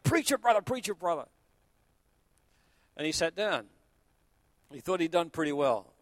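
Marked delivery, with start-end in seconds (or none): 0.0-1.3 mock call-out. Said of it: "getting wilder and wilder in there saying".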